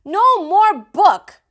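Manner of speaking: put-on high, loud angry child; toddler yell. A narrator's reading. Fudge rejecting the dictionary, high loud yell. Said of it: "Fudge got mad".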